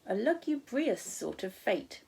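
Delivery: slow, word sounded out with care; careful, slowed reading of a hard word. Real, not acted. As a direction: take lugubrious slowly and deliberately. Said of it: "this is a hard word".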